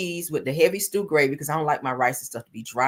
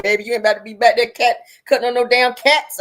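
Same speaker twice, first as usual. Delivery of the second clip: mock-indignant, louder and higher; raised-voice mock protest; retold loud protest; 5 semitones higher, 8 dB louder than usual. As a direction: play up a loud objection. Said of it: "I'm like".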